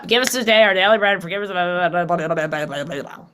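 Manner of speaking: fast, mechanical mumbled grace trailing off; rote rushed prayer. Retold or imitated. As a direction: rattle off the prayer without feeling. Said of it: "There was no feeling to it".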